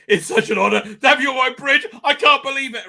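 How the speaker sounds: fanboy meeting his hero; geeking out. Retold or imitated. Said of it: "Shaw geeks out".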